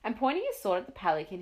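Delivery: ordinary delivery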